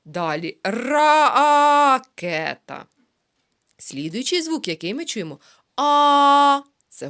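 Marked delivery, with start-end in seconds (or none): 0.6-2.1 slow, loud stretched "ракета"; drawn-out word. Shown as a demonstration. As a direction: sound out "ракета" slowly for children. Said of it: "teacher drawing out word".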